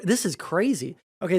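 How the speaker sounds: usual speaking voice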